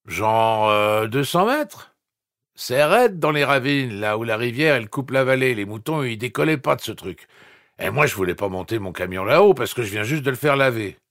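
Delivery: put-on dopey young guy slurring along; slurred voice. A narrator's reading. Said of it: "Voix pâteuse".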